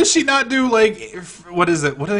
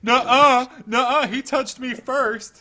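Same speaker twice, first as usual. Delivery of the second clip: put-on higher, whiny little kid denying it; 8 semitones higher, slower than usual. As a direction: play a child saying he didn't start it. Childish denial of blame.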